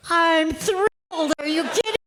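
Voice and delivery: playfully overdone thrilled reply; mock thrilled. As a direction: gush with exaggerated joy, teasing. Mom's teasing reply to son.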